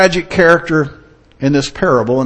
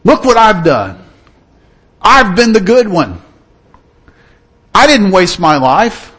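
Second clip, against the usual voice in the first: louder, slow, smug and aggrieved; self-righteous brother; 4 semitones higher, 4 dB louder than usual. Retold or imitated. Mocking the self-righteous churchgoer, smug aggrieved voice. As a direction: boast of your own goodness. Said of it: "like this brother was".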